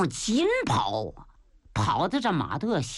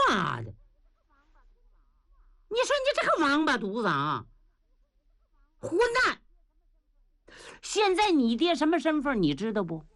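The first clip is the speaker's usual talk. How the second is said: scolding father; 4 semitones higher than usual. Acted. Father scolds son for rushing.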